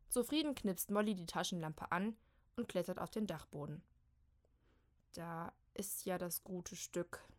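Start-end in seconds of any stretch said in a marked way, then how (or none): 5.1-7.3 soft, slow grumble; low grumble. Acted. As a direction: rumble it contentedly to herself. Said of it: "brummt sie". (leise brummend)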